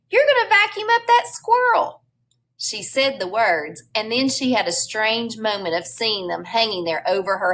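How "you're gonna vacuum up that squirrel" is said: high-pitched, frantic shout; shouted warning. A narrator's reading. Flora warns of the vacuum from the window, shouted. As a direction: yell it high to stop a disaster. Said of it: "she shouted".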